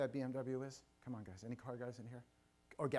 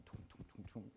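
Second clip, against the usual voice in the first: hushed thumping heartbeat noise; low, soft "toom" pulses, barely voiced; imitated heartbeat; 3 semitones lower, 13 dB quieter than usual. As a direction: mimic a pounding heart under your breath. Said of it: "imagine my heart's going".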